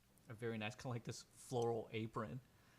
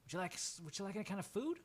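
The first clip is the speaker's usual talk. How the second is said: lightly voiced friendly woman, higher; friendly Jenny; 9 semitones higher, faster than usual. Friendly woman offering the bather food, higher voice. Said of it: "she just goes".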